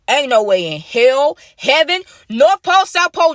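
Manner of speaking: no way I'm meeting you in the bathroom, building outrage; emphatic refusal. Real, not acted. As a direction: pile on the places, each one bigger. Refusing Peyton's text.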